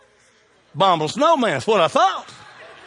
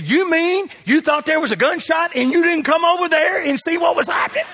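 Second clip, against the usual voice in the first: shocked church lady; 12 semitones higher, 4 dB louder than usual. Retold or imitated. Church lady scolding Elizabeth.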